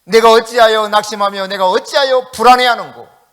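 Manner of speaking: loud, ringing Bible recitation; proclaimed scripture. Acted. Preacher booming the psalm to the congregation, loudly.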